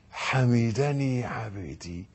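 soft, low and slow, lovingly; tender whisper-soft voice of God. Acted. Preacher voicing God softly to the worshipper. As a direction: speak it gently, as if God answers you. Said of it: "ربنا جل جلاله في علاه يقول".

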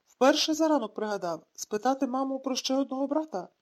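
usual speaking voice

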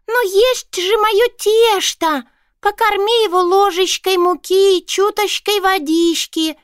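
high, squeaky commanding voice; bossy little mouse. A narrator's reading. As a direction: order her about like a tiny boss. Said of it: "командовала важная Норушонка".

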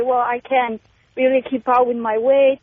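ordinary talk

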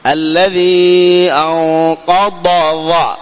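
very slow chanted الذي أنقض, stopping before the ظ; slow sung recitation; careful separation. Shown as a demonstration. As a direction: chant أنقض slowly with a clean ض. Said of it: "هكذا تنطقها".

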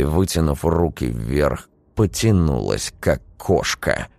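plain reading voice

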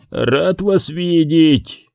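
slow wet gurgle; gurgling voice. Acted. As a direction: gurgle it out, very slowly. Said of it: "пробулькало с другой стороны". (медленный булькающий голос)

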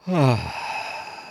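a real, audible sigh of weariness; weary sigh. Real, not acted. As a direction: let out a tired sigh. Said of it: "First sigh of the podcast".

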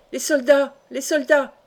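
cried alarm. A narrator's reading. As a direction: sound the alarm urgently. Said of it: "s'écria Jocelyn". (cri d'alarme)

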